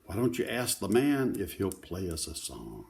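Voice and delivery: plain reading voice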